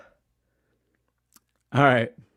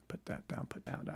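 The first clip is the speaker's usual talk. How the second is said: muttering; 2 semitones lower, 16 dB quieter than usual. Real, not acted. Muttering to self.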